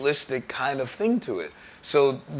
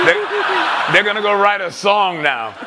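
loud, laughing, higher pitch; laughing shout; 7 semitones higher, 7 dB louder than usual. Real, not acted. Laughing at the walkouts, louder and higher. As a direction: crack up while shouting the joke. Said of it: "laugh shout".

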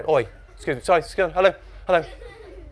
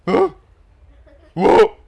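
startled, groggy blurt; sleepy mumble; 6 semitones higher, 7 dB louder than usual. A narrator's reading. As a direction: answer as if just woken. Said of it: "his voice still thick with sleep".

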